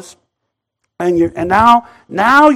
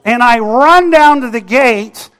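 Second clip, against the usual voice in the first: ordinary delivery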